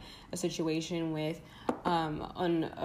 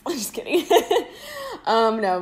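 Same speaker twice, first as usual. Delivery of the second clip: laughing it off; 7 semitones higher, 8 dB louder than usual. Real, not acted. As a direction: giggle through the words. Laughs after her joke.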